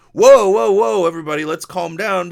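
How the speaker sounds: loud, high whoa easing into a calmer let's calm down; hammed-up peacemaker shouting over everyone; mock calming shout. Imagined calming the quarrel.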